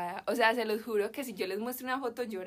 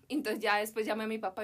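ordinary delivery

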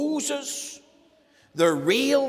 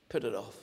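much softer, low Put it off; soft retort; 11 semitones lower, 8 dB quieter, faster than usual. Acted. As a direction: quietly close the matter.

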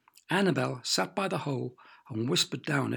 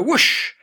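long, loud rushing whoosh; 10 semitones higher, 11 dB louder than usual. A narrator's reading. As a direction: imitate a big rush of wings. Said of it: "a loud, long, fluttering whoosh".

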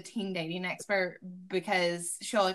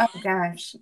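embarrassed oh gosh; 6 dB louder than usual. Retold or imitated. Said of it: "And I was like".

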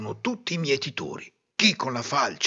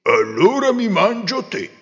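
wolf voice; 7 semitones higher, 6 dB louder than usual. Acted. Wolf threatens the rooster.

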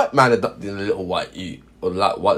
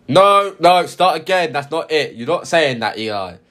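scolding mum; 5 semitones higher, slower than usual. Acted. Mum halts her son's role-play to correct him, scolding. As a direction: cut him off and correct him firmly.

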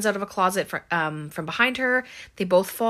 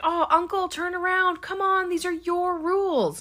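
high, pleading shout at the TV; imitated exasperated yell; mock shouting; 12 semitones higher than usual. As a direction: act out shouting at the screen, pitched way up. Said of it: "Mandy shouting at the T V".

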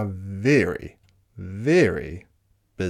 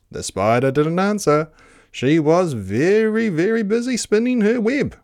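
louder, rising narration; building excitement; 8 semitones higher, 5 dB louder than usual. A narrator's reading. Storyteller stressing how busy the spider was, building excitement. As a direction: build higher and louder to the end. Refrain building with growing busyness.

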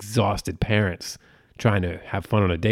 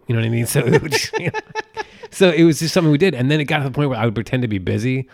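ordinary talk, nothing marked

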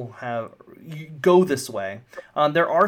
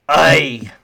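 loud gruff warning to a passenger; a curt, loud dwarf glare in words; grumpy dwarf captain; 10 dB louder than usual. Acted. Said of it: "Grog kinda looks at you".

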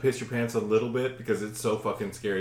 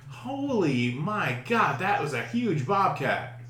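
slow, higher amazement; awestruck; 4 semitones higher, 3 dB quieter, slower than usual. Retold or imitated. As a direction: draw it out in re-enacted awe. Acts awe after the bobcat's leap.

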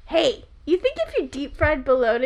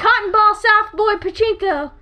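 excited; 5 semitones higher, 6 dB louder than usual. Real, not acted. Calling out answers loudly.

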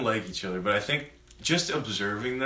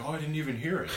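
higher-pitched grandma quote; a hint of an unbothered granny, pitched up; lightly voiced grandmother; 6 semitones higher, 4 dB quieter, much faster than usual. Grandma reassuring the band about the rehearsal noise, lightly voiced. Retelling grandmother's carefree reply.

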